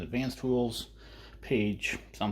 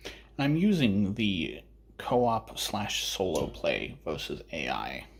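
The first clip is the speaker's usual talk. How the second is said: ordinary delivery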